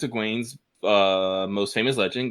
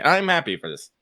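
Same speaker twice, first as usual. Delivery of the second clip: ordinary talk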